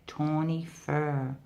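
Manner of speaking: usual speaking voice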